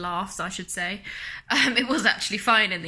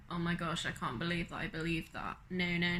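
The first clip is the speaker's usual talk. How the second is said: ordinary talk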